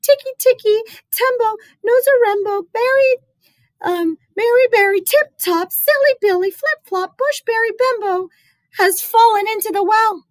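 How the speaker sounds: put-on high, loud frantic boy; crying out. Chen running to his mother, high panicked cry. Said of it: "he cried".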